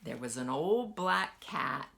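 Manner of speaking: usual speaking voice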